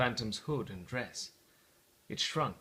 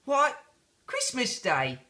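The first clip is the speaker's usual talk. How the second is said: lightly voiced boy; 9 semitones higher, slower than usual. The boy answers.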